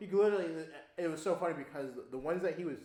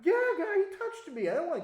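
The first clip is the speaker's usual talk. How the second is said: much higher and louder, laughing defense; excited; 11 dB louder than usual. Real, not acted. To his friend, a high loud amused protest. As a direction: justify it with amused outrage.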